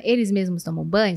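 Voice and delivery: usual speaking voice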